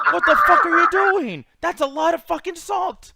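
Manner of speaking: loud, high, incredulous retold yell. Customer watching salt pour onto popcorn, outraged yelling. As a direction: shout it in outraged disbelief. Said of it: "So I'm yelling at him".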